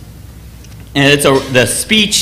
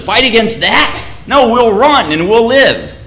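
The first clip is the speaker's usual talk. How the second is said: frightened soldier; 6 semitones higher than usual. Retold or imitated. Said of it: "One of them says".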